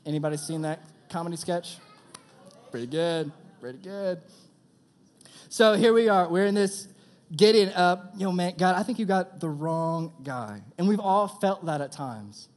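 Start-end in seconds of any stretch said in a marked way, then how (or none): 2.7-3.3 sketch catchphrase. Retold or imitated. Quoting a comedy sketch catchphrase. 3.6-4.2 sketch catchphrase. Retold or imitated. 5.5-12.5 loud, high, fervent preaching. Acted. Youth pastor preaching to the group, loud and fervent.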